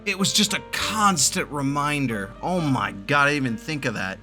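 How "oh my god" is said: ordinary talk, nothing marked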